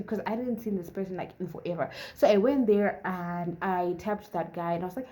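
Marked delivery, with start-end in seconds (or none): none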